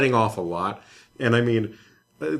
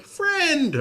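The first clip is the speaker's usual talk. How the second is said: very high, sweet voice; naive player; 4 dB louder than usual. Pretend. New player greeting the Goomba, sweetly high. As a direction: coo it like a trusting newbie. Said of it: "you're like".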